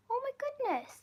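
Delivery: thrilled squeal at the news; delighted gasp. Real, not acted. Reacting to a viewer's brother in the UK, excited squeal. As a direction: pitch leaps way up on goodness.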